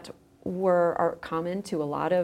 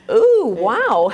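loud, drawn-out ooh of wonder; a hammed-up gasp of awe; mock amazed ooh; 2 semitones higher, 9 dB louder than usual. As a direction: act out being impressed by the gory myth. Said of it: "you think".

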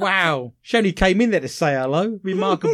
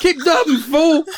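higher, louder laughing yell; mock shout; 11 semitones higher, 7 dB louder than usual. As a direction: yell it across the bingo hall. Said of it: "What did you shout out to Michelle".

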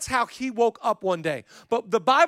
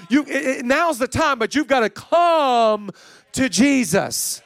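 full-force preaching; 5 semitones higher, 6 dB louder than usual. Acted. Urging the congregation off the couch, loud preaching. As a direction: preach it hard, stretching each come.

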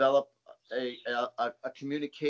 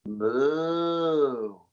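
a drawn-out moo, pitched up; mooing like a cow; 6 dB louder than usual. Retold or imitated. Psychic moos at the grieving client. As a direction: give her a cow's moo. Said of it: "I don't know why I'm doing this, but".

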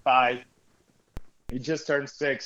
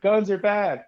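mocking quote; 8 semitones higher, 5 dB louder, slower than usual. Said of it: "One of those people who are like".

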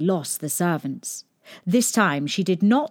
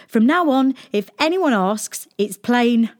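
put-on teasing boy; 4 semitones higher, 3 dB louder than usual. A narrator's reading.

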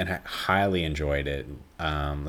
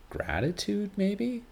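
uncertain; 4 dB quieter, slower than usual. Real, not acted. Unsure word search.

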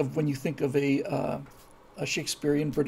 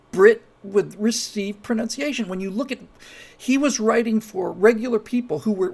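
impassioned; 4 semitones higher, 7 dB louder than usual. Real, not acted.

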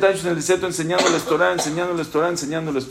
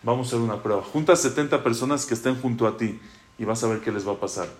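gentle reassurance; 6 semitones lower, 5 dB quieter, faster than usual. Acted. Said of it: "le dice Hashem".

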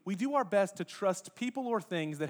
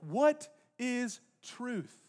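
high, drawn out word by word; very slow, ringing question; weighty rhetorical question; 5 semitones higher, much slower than usual. Real, not acted. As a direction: stretch each word out for the congregation. Preacher repeats question to congregation.

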